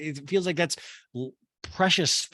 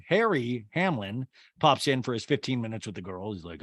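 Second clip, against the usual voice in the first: ordinary delivery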